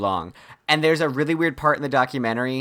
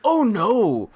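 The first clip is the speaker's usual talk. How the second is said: mock horror; 7 semitones higher, slower than usual. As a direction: squeal it like a dismayed viewer. Reacting in horror to the scene.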